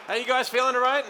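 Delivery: loud crowd greeting; calling out to crowd. Real, not acted. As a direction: fire up the room.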